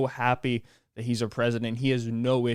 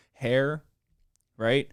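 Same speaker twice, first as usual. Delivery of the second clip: cracking up mid-sentence; breaking into laughter. Real, not acted. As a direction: laugh before getting the word out. Cracking up at the photos.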